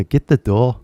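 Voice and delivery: casual British imitation; 軽いイギリス英語のまねで; mock British accent. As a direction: get the door again in a lightly British voice, door with no r. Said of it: "聞こえた？".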